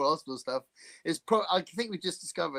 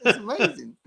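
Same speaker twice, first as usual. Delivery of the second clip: mock praise; 7 semitones higher, 8 dB louder than usual. Mock praising Dave like a pupil.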